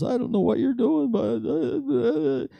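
high, breaking voice of a man crying; sobbing. Retold or imitated. Man overwhelmed after prayer, sobbing high and broken. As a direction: let the words break into sobs. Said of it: "The guy was sobbing".

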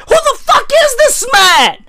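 high-pitched incredulous outburst; exaggerated disbelief. Asking who this honoured man is, incredulous. Retells own disbelief at scene.

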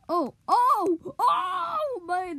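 loud high excited shout; cheering at a game. Real, not acted. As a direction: yell with amazement at the play. Reacting to a wild play.

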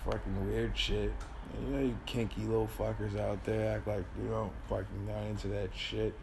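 ordinary talk, nothing marked

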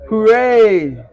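mock cheer. Mocking [name] quote.

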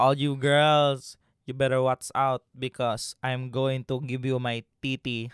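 put-on Filipino accent.

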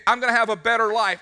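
high, loud, eager declaration; excited. Acted. Believer insisting to a doubter, excited. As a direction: declare it with bursting hope. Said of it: "what are you so excited about".

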